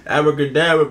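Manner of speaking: loud hyped name call. Real, not acted. Hyped shout of the artist's name.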